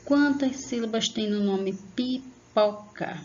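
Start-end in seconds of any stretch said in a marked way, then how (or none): 1.9-3.2 "pipoca" slowly split into pi, po, ca; syllable by syllable. Shown as a demonstration. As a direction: sound out "pipoca" in three spaced beats. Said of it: "abri a boca pausadamente". ("pipoca" pausadamente: pi-po-ca)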